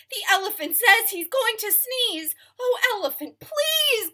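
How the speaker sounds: very high, loud squawking bird; shrieking parrot. Acted. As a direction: screech the warning like a parrot.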